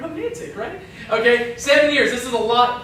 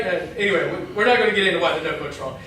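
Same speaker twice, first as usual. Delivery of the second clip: ordinary talk, nothing marked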